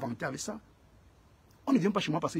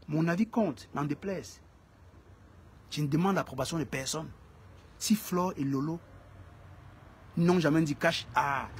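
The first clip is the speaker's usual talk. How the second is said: normal talking voice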